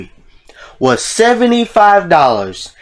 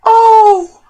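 high, drawn-out oh; long high-pitched cry of shock; aghast shock; 5 dB louder than usual. Real, not acted. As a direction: wail it high in disbelief. Shocked at baby being kicked.

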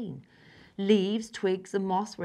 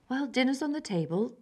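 mum's voice; 7 semitones higher than usual. Acted. Mum calls to dinner.

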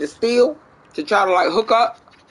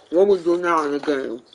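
talking while crunching chips; 4 semitones lower, 4 dB quieter than usual. Real, not acted. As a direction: speak through a mouthful of chips. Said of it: "forgive my crunching".

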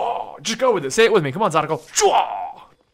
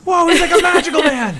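loud, high-pitched laughing amazement; amazed; 13 semitones higher, 8 dB louder than usual. Real, not acted. Player astonished by a magical man in game, laughing amazement. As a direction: blurt it out in squealing wonder.

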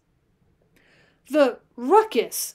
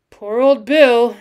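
loud, slow lament; mournful exclamation; 11 dB louder, slower than usual. A narrator's reading. Cowboy laments the dead lion, mournfully. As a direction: draw it out mournfully, falling at the end. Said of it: "he exclaimed mournfully".

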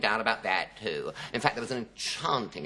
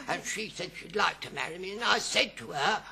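put-on ranting old man; 8 semitones higher than usual. Old man in a chemist's to Stanley, ranting. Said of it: "this old man was ranting on".

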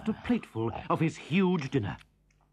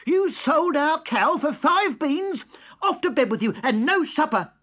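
much higher, louder scolding; furious mother; 11 semitones higher, 6 dB louder than usual. A narrator's reading. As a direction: scold the boy for selling the cow. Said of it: "she was furious with him".

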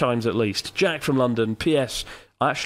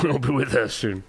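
sinister voice. Acted. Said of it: "Sinister boy".

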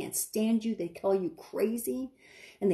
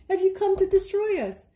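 high, loud demon shriek; 11 semitones higher, 5 dB louder than usual. Acted. Demons asking the Son of God, high shriek. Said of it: "The demons are asking God".

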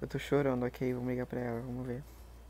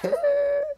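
high, loud burst of laughter; 10 dB louder than usual. Real, not acted. Laughing at a fan's shocked reaction, loud and high.

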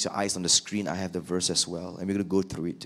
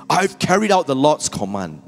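higher and louder, breezy; smug cheerful lie; 6 semitones higher, 7 dB louder than usual. Acted. Saul claiming obedience to Samuel, smugly cheerful. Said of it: "Saul greeted him cheerfully".

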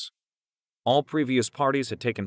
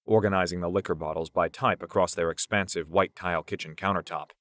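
plain reading voice

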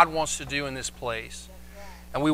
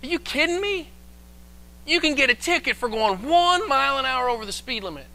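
high-pitched disbelief; incredulous; 8 semitones higher than usual. Retold or imitated. Pastor discovers one mile over earns a ticket, incredulous. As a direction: act out amazed indignation, pitched well up. Retelling shock at ticket rule.

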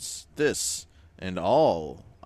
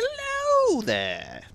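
very high, slow and louder; loud squeaky greeting; 8 dB louder, much slower than usual. Acted. Player greets the character again, very high and louder. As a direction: squeal the hello out.

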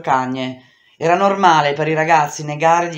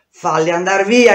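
loud, desperate cry, pushed out to the last breath; shouting with all her breath; 4 semitones higher, 7 dB louder than usual. Acted. A cornered girl screams at the boys to leave, at full breath. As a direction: yell it with every bit of air left in your lungs. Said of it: "gridai con tutto il fiato che mi restava nei polmoni".

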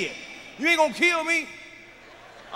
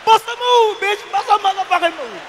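much higher, loud mock threat; hammed-up confrontation; 10 semitones higher, 4 dB louder than usual. Comedian retelling his face-off with the wife, high and loud. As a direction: squeal it out ready to fight. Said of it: "I said".

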